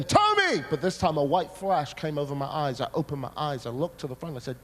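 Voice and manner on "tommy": high, loud call of a name; sudden high-pitched shout. Retold or imitated. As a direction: call out sharply to wake a sleeper. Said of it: "And I heard again".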